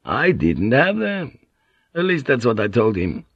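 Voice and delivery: lilting, animated foreign-sailor voice; boisterous Portuguese pilot. Acted. Portuguese pilot telling Blackthorne how Morrow treated him, boisterous. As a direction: play a talkative, swaggering Portuguese pilot.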